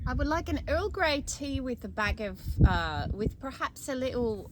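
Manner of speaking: put-on British accent drifting towards Australian. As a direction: order tea in a British accent. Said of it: "You do a great British accent".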